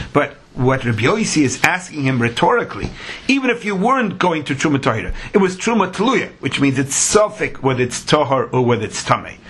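usual speaking voice

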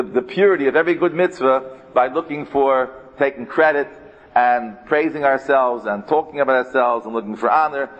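ordinary talk

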